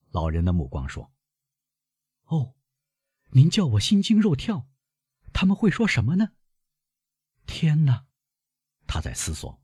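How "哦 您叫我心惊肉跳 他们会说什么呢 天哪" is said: put-on high-pitched, agitated young woman; anxious girl, voice pitched well up; alarmed granddaughter. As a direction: exclaim high, as if frightened by the news.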